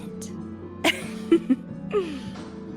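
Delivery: light, breathy teasing giggle; amused, knowing little laugh. Acted. Teasing a wary newcomer.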